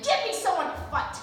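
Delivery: Thor, fuming, demands an opponent: a loud angry cry. Retold or imitated. Thor furious after losing, shouting for a fight. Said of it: "he is fuming".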